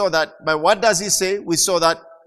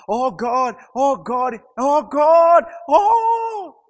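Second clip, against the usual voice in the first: rising desperate shout, climbing higher; panicked cry; 9 semitones higher, 6 dB louder, much slower than usual. Pretend. Imagined bystander crying out in panic, rising shout. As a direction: cry out in terror as it rolls back.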